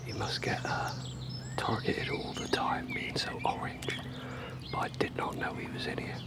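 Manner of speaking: hushed whisper so the koi isn't spooked. Real, not acted. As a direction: whisper softly, stay super quiet near the fish. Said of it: "I'm gonna be super quiet".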